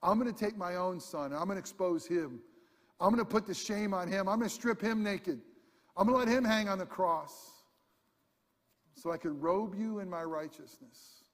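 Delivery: loud, high voice of God in the sermon; preaching at full force. Acted. Preacher voicing God's warning to the people, loud and high. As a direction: thunder it out for the congregation.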